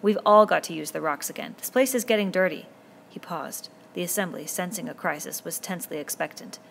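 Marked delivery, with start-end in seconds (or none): 0.0-2.7 put-on a boy leader speaking up firmly to a noisy crowd; lightly voiced boy chief, louder than the narration; Ralph addressing the boys. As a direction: raise your voice to the assembly and hold their attention.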